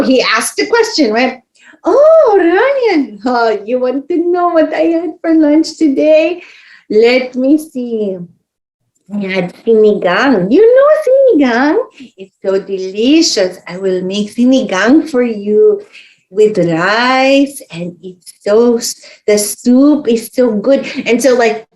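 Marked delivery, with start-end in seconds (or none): 1.8-10.5 delighted grandma. Retold or imitated. Grandma voiced telling about lunch. 10.5-11.8 much higher, eager "You know sinigang?"; eager question. Retold or imitated. Grandma asking eagerly. 12.2-20.9 delighted grandma. Retold or imitated. Grandma voiced telling about lunch.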